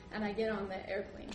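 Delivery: normal talking voice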